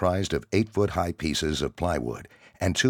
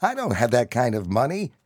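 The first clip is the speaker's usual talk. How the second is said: higher, slightly louder anxious answer; nervous reply; 8 semitones higher, 3 dB louder than usual. Acted. Skinny kid answering the angry soldier. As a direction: protest with a wobble of fear. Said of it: "the skinny kid replied".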